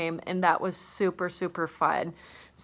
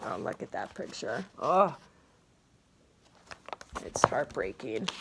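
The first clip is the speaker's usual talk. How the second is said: choked-up aside; 6 semitones lower, 4 dB quieter than usual. Real, not acted. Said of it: "a little emotional".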